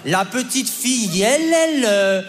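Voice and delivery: slow, exaggerated dictation that hammers each sound with yi, yi and eust; mother over-dictating. Mother forcing her son through a dictation, exaggerated and slow. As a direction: mime a mother spelling it out syllable by syllable. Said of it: "Elle était là".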